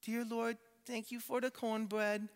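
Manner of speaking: put-on high, soft little girl praying; sweet toddler voice saying grace; cute little girl voice. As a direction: pitch it up like a small child saying grace. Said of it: "in her cute little voice".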